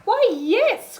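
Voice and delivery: high, loud glad cry; an eager yes, pitched high and loud; cried. A narrator's reading. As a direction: witch cheerfully welcoming the bird, loud and high. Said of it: "cried the witch".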